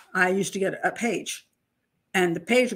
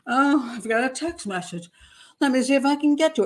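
mock drowsy; 4 semitones higher than usual. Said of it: "I didn't drowsily".

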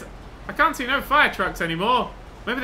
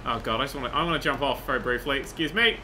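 normal talking voice

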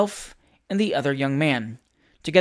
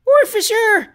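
shrieked much higher and loud; screaming; 6 dB louder, slower than usual. A narrator's reading. The ladies scream for a policeman during the brawl. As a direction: scream for help in panic. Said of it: "screamed the ladies".

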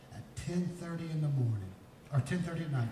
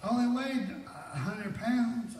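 mildly mock-indignant, higher and slow; exaggerated protest; 9 semitones higher, slower than usual. Preacher recalling his skinny teen self to the congregation, exaggerated protest. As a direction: protest it high, drawn out.

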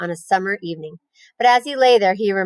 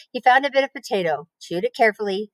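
ordinary delivery, nothing marked

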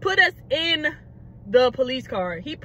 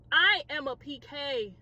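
usual speaking voice

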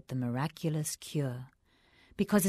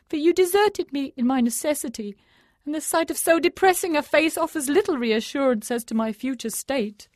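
groaning thief; 10 semitones higher, 6 dB louder than usual. A narrator's reading. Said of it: "groaned the thief".

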